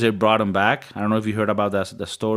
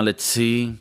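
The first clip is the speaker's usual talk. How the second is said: usual speaking voice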